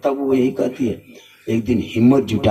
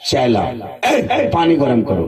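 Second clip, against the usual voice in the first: scolding husband; 7 semitones higher than usual. Retold or imitated. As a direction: rattle off demands with rising threat. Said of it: "बीवी को डांटते था".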